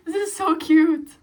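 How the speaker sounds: loud, giddy cooing; gushing delight. Real, not acted. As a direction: burst out adoring it. Gushing over new purchase.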